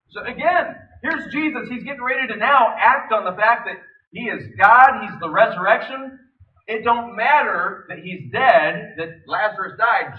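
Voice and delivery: loud, driving preacher voice; full-force sermon delivery; preaching. Acted. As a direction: preach it hard to the congregation. Preaching builds up.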